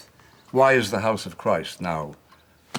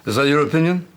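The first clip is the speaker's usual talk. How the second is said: loud, high, indignant demand; sharp challenge; 7 semitones higher, 7 dB louder, faster than usual. Acted. Angry demand to the bishop about the memoirs. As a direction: challenge him sharply across the table.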